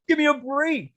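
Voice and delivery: scoffing. Retold or imitated. Scoffing at the cop.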